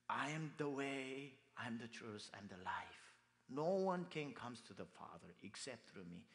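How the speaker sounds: slow, soft solemn reading; hushed reverent verse; scripture recitation. Acted. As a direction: recite each phrase deliberately.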